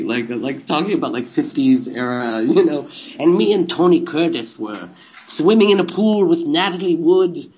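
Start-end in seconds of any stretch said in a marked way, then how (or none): 3.2-7.5 lisping statue character, raised in pitch; Elvais. Retold or imitated. Statue reminiscing about fifties stars, lisping character voice. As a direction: tell it in a silly lisped voice. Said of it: "that's what Elvais was to me".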